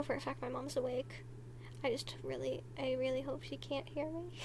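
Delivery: quietly, wary of being heard; hushed. Real, not acted. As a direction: keep it down so mom won't overhear. Hopes mom cannot overhear.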